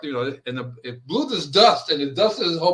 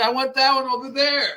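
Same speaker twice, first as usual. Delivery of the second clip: higher, louder imitation of an old lady picking a tomato; 10 semitones higher, 4 dB louder than usual. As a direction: call out like a choosy old lady pointing at one. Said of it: "The old lady would go into the yard and say".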